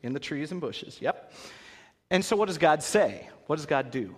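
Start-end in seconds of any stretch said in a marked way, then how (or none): none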